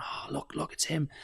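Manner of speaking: hushed, whispered gossip; imitated whisper. Shoppers whispering as they point at the comedian, hushed. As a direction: whisper it as if spotting a celebrity. Locals pointing at him in Asda.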